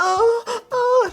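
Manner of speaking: very high mock moan. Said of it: "She was like".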